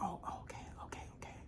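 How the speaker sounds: breathy imitating whisper, very soft; mock whisper. Recapping a contestant's meek reply, whispered mockingly. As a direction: mimic her meek little whispered agreement. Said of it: "And you was like".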